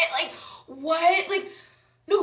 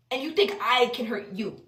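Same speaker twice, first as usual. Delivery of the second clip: ordinary delivery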